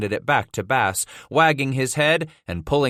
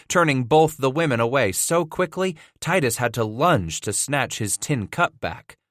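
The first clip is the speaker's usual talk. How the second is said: ordinary delivery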